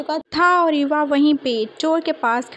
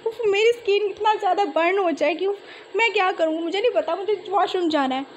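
panicked calling; 4 semitones higher than usual. Acted. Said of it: "एरन जो था चिल्लाई जा रहा था".

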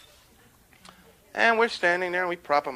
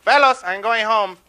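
[name]; 4 semitones higher, 7 dB louder than usual. Acted. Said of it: "[name]'s starting to close up".

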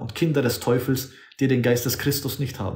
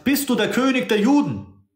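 raised, questioning Pilate voice; Pilate's question; 10 semitones higher than usual. Retold or imitated. Preacher voicing Pilate questioning Jesus, raised pitch. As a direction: ask it as Pilate would, pitched up. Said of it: "Pilatus hat gesagt".

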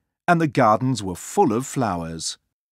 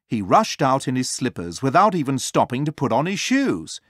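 ordinary delivery

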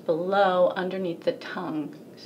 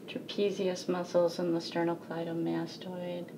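ordinary talk, nothing marked

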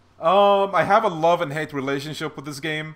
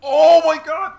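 excited shout; 14 dB louder, slower than usual. Real, not acted. Sudden big win in game.

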